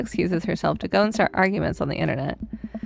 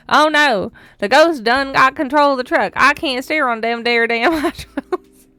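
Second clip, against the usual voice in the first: louder, higher Southern trucker twang; hammed-up hillbilly drawl; 5 semitones higher, 6 dB louder than usual. Shown as a demonstration. Hammed-up hillbilly drawl for a reality TV trucker scene. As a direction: ham up a backwoods trucker panicking on the ice. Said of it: "It's so hard not to read it like".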